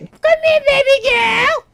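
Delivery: very high, loud squeal calling the dog; high-pitched pet voice. Real, not acted. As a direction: shriek it sweetly in falsetto to a pet. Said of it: "She likes the high-pitched voice".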